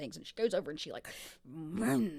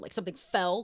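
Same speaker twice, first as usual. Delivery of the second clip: ordinary talk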